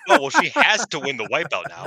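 loud, high mock fan voice. Podcaster mocks fans predicting a title win, loud and high. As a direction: ham up the naive fan who is sure.

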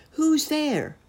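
high, loud call to the door; brave cry. A narrator's reading. Boy calls bravely at the knock, loud and high. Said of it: "cried Friedrich bravely".

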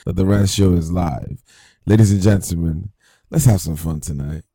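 loud, booming announcer welcome; show host hype. Acted. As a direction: pump up the live audience. Opening a live show.

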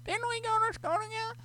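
put-on high, eager "old friend" voice; fake familiar caller. Shown as a demonstration. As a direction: squeak it up like a long-lost classmate. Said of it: "Then I can be like".

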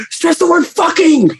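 high, mock director calling across the room; mock crew member shouting a note across the set. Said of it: "They kept on saying across the room".

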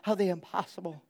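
soft old-lady impression; old woman's voice. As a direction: counsel a friend as a quiet elderly woman. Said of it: "she says it in her old voice".

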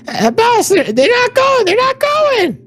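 very high, squeaky goblin whine; high-pitched, frantic creature voice; alarmed goblins. Acted. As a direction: report trouble to the boss in a panicked squeal. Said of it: "you hear the goblins say".

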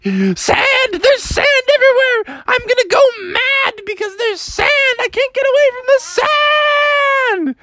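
very high, hysterical frog rant; frantic. Acted. Froggy telling mom about the sand, frantically wailing. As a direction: wail as if losing your mind.

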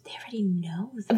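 soft unvoiced whisper; whispered aside. Real, not acted. Podcaster's dry aside to her co-host, whispered. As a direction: murmur it under your breath.

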